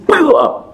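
sudden high-pitched "buam"; imitated blast noise. Preacher releasing God's power on a flying man, imitated blast. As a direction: burst it out like a blow landing.

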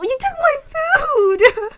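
slow, high fake wail; mock crying. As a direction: whine it like a wronged child. Friend ate all the food.

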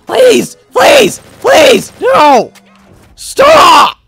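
high, loud panicked begging; frantic begging shouts; desperate pleading. Real, not acted. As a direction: cry out each word in desperation. Desperate pleading during game.

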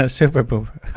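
laughing through the name; real laughter breaking into the words. Real, not acted. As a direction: giggle while you repeat it. Rereading the name while laughing.

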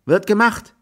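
high, loud shouted reply; high-pitched carrying answer; calling back. A narrator's reading. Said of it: "rief Jack".

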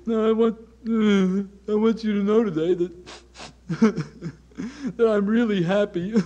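hammed-up crying while claiming happiness; mock blubbering. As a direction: sob exaggeratedly through the words. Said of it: "you're just blubbering all over yourself and you're crying".

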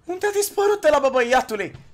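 high, loud bewildered cry; loud disbelief. Real, not acted. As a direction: exclaim in disbelief, starting very high. Opponent vanished in game. (strigăt înalt de uimire)

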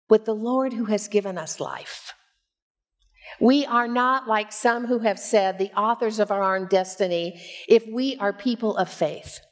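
normal talking voice

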